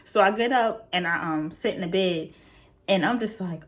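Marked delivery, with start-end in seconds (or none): none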